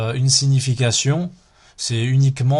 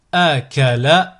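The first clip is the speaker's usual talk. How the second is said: slow, each syllable drawn out separately; split into syllables; 4 dB louder, slower than usual. Shown as a demonstration. Teacher models a word for learners to repeat, split into syllables. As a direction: cut the word up, pausing between syllables. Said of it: "décomposer le mot".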